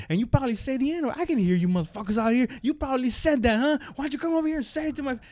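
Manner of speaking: fast, high-pitched rant; imitated angry challenge. Retold rant, daring bystanders.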